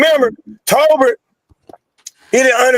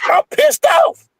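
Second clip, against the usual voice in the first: much higher, yelled out; hyped-up outburst; slower than usual. Real, not acted. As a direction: shout it up high, fired up by the host. Hyped up by the host.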